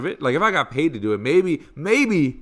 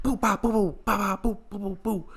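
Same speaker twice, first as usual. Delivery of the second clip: high-pitched mock punch flurry; boop-ba-boop punch imitation; imitated punch noises; 6 semitones higher than usual. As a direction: rattle off cartoon punch sounds.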